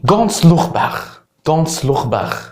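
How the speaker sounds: Scouse with ts and throaty kh endings; Scouse accent. Shown as a demonstration. Teacher demonstrating Liverpool speech to learners, exaggerated Scouse accent. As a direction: Liverpool accent, scraped kh in "لوخ باخ". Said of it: "تحس انهم بيتكلموا عبري مش انجليزي".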